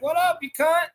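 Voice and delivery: high, loud matey greeting; mate's greeting. Retold or imitated. Greeting a best mate with a term of endearment, high and loud.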